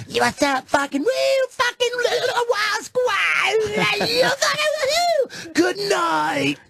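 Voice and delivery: high, wild mock shout to the crowd; hammed-up stage yell. Comic mimics his own wild closing to the crowd, high mock yelling. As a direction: scream the sign-off like a crazed comic.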